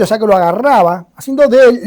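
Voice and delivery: normal talking voice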